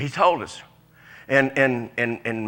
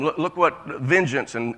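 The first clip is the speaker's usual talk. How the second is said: ordinary talk, nothing marked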